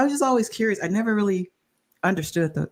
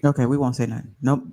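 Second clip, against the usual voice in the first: ordinary talk, nothing marked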